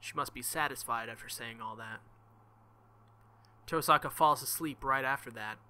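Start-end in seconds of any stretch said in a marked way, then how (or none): none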